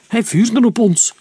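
usual speaking voice

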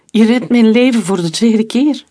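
high, loud, overwhelmed; mildly tearful amazement, voice lifted; shaken through tears. A narrator's reading. Rescued woman thanking her saviour, shaken through tears. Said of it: "klonk het schokkend door haar tranen heen".

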